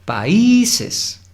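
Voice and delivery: "Países" with the "í" sung out very high and loud; strong stress on the i. Shown as a demonstration. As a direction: lift the "í" of "Países" hard. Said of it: "La I es la sílaba tónica". ("Países" con la "í" muy marcada y aguda)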